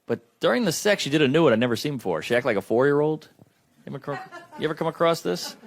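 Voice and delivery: ordinary talk, nothing marked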